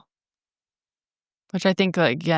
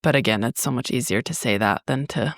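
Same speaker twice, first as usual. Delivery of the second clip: ordinary delivery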